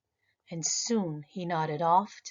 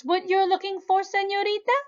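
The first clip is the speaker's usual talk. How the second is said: higher, friendly calling; manager's voice; 12 semitones higher, 5 dB louder than usual. Acted. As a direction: offer the bear kindly.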